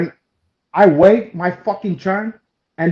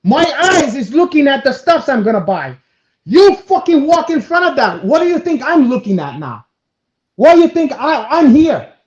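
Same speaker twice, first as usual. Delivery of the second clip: loud, raised-pitch telling-off; indignant scolding; 7 semitones higher, 5 dB louder than usual. Retold or imitated. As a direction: scold the person who cut in front. Retold quarrel with a queue-jumper.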